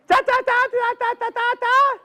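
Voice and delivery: high, insistent squirrel jabber; squirrel chatter. Acted. Squirrel asking the man in the store, high chattering.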